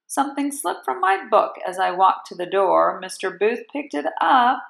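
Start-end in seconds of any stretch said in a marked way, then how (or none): none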